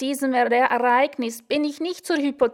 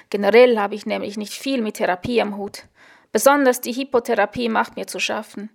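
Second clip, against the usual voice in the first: ordinary delivery, nothing marked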